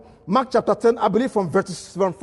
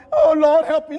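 mock tearful plea; 5 semitones higher, 3 dB louder than usual. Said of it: "It is not crying of".

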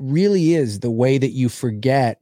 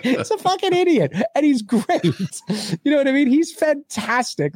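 laughing; 10 semitones higher than usual. Real, not acted. Laughing about Grover.

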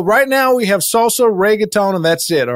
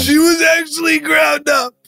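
put-on tearful child; 7 semitones higher than usual. Child recalls mother's gruesome death.

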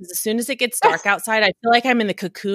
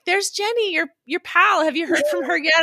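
high, sing-out teasing tone; teasing husband; 10 semitones higher than usual. Retold or imitated. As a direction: imitate a husband needling his wife, pitched way up. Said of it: "my husband always teases me".

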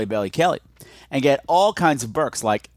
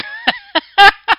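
shrieking laugh; 9 dB louder than usual. Real, not acted. Cracking up at her own joke.

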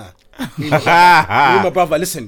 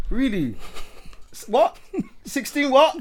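incredulous dad; 9 semitones higher, 4 dB quieter, slower than usual. Retold or imitated. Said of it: "be like".

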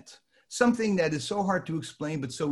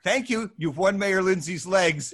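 imitating John; 7 semitones higher, 5 dB louder than usual. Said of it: "And he hangs up on me".

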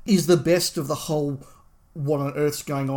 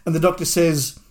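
normal talking voice

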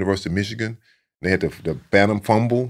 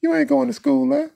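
much higher, gently calm mock mother; mama's calm question. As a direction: echo mama's calm, knowing question, pitched way up. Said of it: "She said it real calm".

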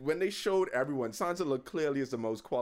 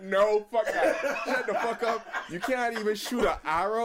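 higher-pitched jeering through laughter; audible heckling; 6 semitones higher than usual. Retold or imitated. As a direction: heckle him, high and amused. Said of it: "all of us just audibly".

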